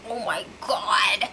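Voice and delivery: imitating a stunned whisper; shocked whisper. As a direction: hush it out in alarm. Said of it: "Cody's like".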